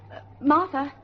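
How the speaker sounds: calling out. Acted. Calling out to Aunt Martha.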